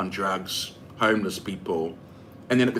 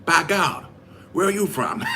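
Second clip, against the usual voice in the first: high-pitched mock American accent; 6 semitones higher than usual. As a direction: imitate an astonished American stranger's accent. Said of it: "How's my American accent?".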